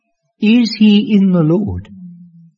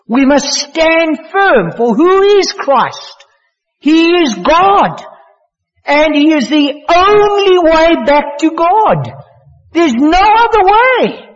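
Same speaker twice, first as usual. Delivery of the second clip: higher and slower, rising in fervour; fervent preaching; 7 semitones higher, slower than usual. Acted. Preacher urging the congregation to stand firm, rising fervour. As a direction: build the proclamation up, raising the pitch.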